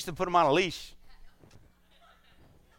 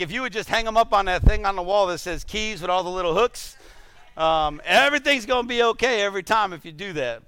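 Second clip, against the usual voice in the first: wife's instructions; 5 semitones higher, 6 dB louder than usual. Retold or imitated. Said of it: "she's like".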